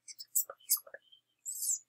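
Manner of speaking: soft whispered please; whispered begging. Real, not acted. Gamer begging under breath for the trade. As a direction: whisper it like a prayer.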